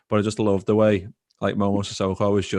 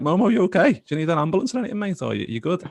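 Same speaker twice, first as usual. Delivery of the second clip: mock panic; 9 semitones higher than usual. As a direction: rattle it off like you fear the worst. Rings back, fears an emergency.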